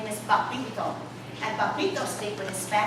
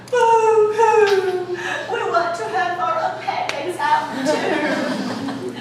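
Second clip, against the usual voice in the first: high, loud, hammed-up boo-hoo wail, slower on the second line; mock sobbing children, much higher and louder; mock crying girls; 10 semitones higher, 10 dB louder, slower than usual. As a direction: wail it like a crowd of little girls wanting attention. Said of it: "And all the little girls cried".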